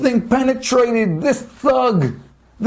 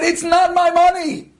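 higher, raised refusal; defiant cry; 7 semitones higher than usual. Acted. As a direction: cry out the refusal under blows. Rabbi answers being beaten.

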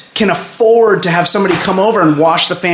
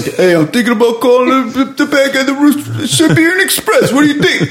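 high, eager pitch of a writer; mock Stallone pitching; 8 semitones higher than usual. Stallone pitching the Siberian Express to writers, high and eager. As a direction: pitch the villain's name brightly to the room. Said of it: "Sylvester wrote this movie".

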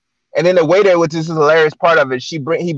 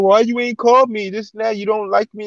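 higher-pitched imitation of a complaining date; retold whiny complaint; 6 semitones higher than usual. Date arriving late at night to complain, mock accusation. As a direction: mimic her pouty accusation, pitched up.

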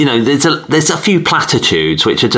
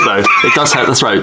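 usual speaking voice

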